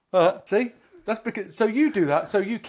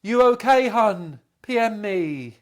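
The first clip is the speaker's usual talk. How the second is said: loud, high mock concern; mock online sympathy; 4 semitones higher, 6 dB louder, much slower than usual. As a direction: put on exaggerated Facebook concern. Mocking Facebook-style sympathy messages.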